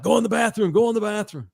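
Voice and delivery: high-pitched imitation of a panicking girl; hammed-up panicked order; mock panic. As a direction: urgently shoo someone away in a high voice. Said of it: "This chick panics".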